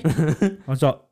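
ordinary delivery

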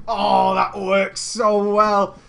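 loud, very drawn-out approval; delighted. Real, not acted. Streamer delighted with the sexy name, drawn out. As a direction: stretch it out with relish.

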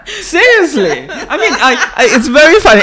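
high, laughing through the words; can't stop giggling at the absurdity. Real, not acted. Laughing along with the co-host about a job ad, high and giggly.

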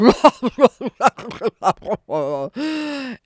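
loud booming belly laugh; hearty ogre laugh. Acted. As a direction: laugh big and proud like a giant. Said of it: "The ogre gave a hearty laugh".

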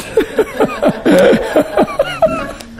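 a burst of high delighted laughter. Real, not acted. Preacher laughing fondly at squabbling disciples.

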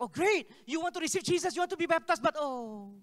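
high, loud enthusiasm that drops off at "but oh"; overjoyed until the snag hits; excited, then deflating. Retold or imitated. Excited, then catches the problem.

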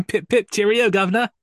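high, loud mock Cockney cheer; hammed-up British. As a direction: overdo a British toff cheerio, laughing. Mock British after being teased.

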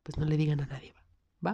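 hushed, conspiratorial aside; secretive whisper. Real, not acted. Host whispering a secret to listeners, hushed. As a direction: whisper it like a secret just between friends. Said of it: "aquí entre nos".

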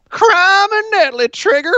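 very high, drawn-out cartoon squawk; voice-cracking cartoon character. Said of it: "he cracks his voice constantly".

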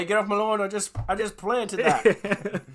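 put-on high, loud, slow grumpy old bloke; aussie tradesman. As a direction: shout like a cranky Aussie neighbour.

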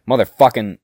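sudden loud furious curse, pitch shooting up; bursting into a rage-filled swear; furious outburst. Acted. Losing temper, outburst.

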